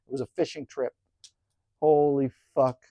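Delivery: ordinary talk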